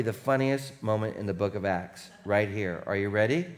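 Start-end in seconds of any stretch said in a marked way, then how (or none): none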